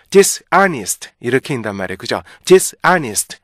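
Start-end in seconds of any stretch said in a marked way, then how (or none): 0.1-1.1 "dishonest" split as dis + honest, slowly; Korean learners' misreading. Shown as a demonstration. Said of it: "우리나라 사람들 이거 읽는 거". 2.4-3.4 "dishonest" as two loud, separate words, dis and honest; mistaken split reading. Shown as a demonstration. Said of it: "이렇게 읽으면 안 돼요".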